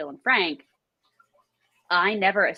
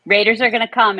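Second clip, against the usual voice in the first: mock warning; 3 semitones higher, 8 dB louder than usual. Retelling a warning from the show.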